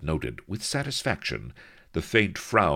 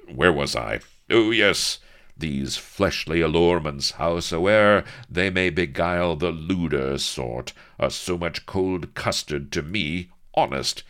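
louder, grand archaic protest; blustering captain; 4 semitones higher, 7 dB louder than usual. A narrator's reading. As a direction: reclaim your dignity loudly. Avery recovering with bluster.

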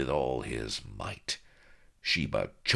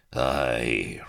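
rasped; 2 semitones lower, 5 dB louder than usual. A narrator's reading. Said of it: "rasped the Admiral, in what he imagined was a conspiratorial whisper".

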